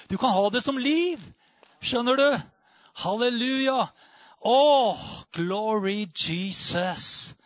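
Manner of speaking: high, loud, impassioned preacher voice; rising revival preaching; fervent preaching. Acted. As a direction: preach it out with full fire. Ecstatic preaching to congregation.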